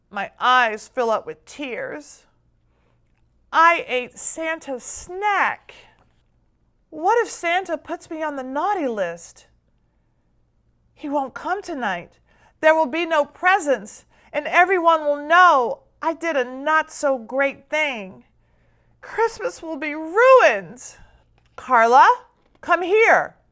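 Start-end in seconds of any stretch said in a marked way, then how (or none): 3.5-21.0 spiralling into panic; worried. Acted. Child panics over eaten cookie. 21.6-23.4 loud high call across house. A narrator's reading. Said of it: "Granny calls out".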